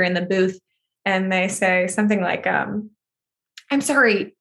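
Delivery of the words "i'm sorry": higher, tentative question; hesitant disbelief. Retold or imitated. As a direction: imitate someone carefully asking in disbelief. Studio staff doubting it is true.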